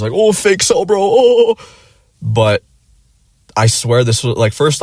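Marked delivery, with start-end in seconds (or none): 0.1-1.6 very high, sarcastic online jeer; mock it like a snide troll; mocking commenters. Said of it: "like, the comments".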